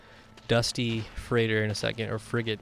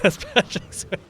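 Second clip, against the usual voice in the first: laughing; 8 semitones higher, 4 dB louder, slower than usual. Real, not acted. Laughing echo.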